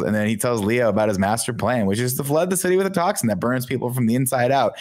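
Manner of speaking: ordinary talk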